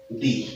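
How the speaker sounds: दी with a long ee vowel, unstressed; long "dee". Shown as a demonstration. Teacher models दी before a vowel sound, long ee. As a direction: say दी with a long ee, not stressed. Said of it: "यानी बड़ी दी".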